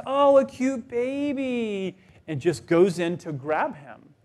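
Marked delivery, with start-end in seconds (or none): 0.1-2.0 put-on high, slow gushing coo over a baby; tipsy cooing lady. Stranger at the game gushing over the baby, high slow coo. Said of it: "this lady right beside my wife says".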